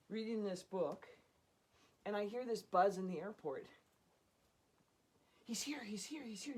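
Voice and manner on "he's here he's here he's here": hushed, eager whisper of a crowd; breathless whispered rumor; excited whisper. Retold or imitated. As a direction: whisper it like passing on big news. Crowd buzz at the airport.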